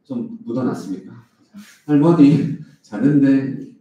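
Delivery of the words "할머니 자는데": put-on Gyeongsang accent, loud and slow, 할머니 stressed; a child repeating it in thick Gyeongsang dialect; Gyeongsang-accented child on the phone. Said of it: "이렇게 이제 경상도 말로".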